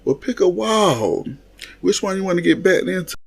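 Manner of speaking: mocking New York. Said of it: "New York talking about".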